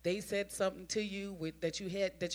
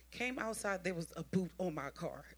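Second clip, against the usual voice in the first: usual speaking voice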